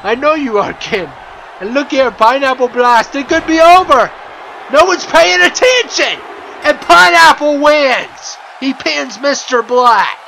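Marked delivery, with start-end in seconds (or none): 1.6-10.2 excited finish call. Real, not acted. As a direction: call the pin like a title moment. Calling the winning pin.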